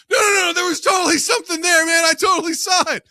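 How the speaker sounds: high, full-volume mock panic; hammed-up frantic protest; insistent freaked-out witness. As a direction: shout it, desperate to be believed. Acting out insistent spooked witness.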